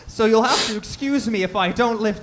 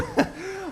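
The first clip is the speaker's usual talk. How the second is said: chuckle; 12 semitones higher than usual. Acted. Discord laughing at own joke.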